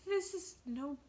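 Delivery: scared. A narrator's reading. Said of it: "he said in a scared voice".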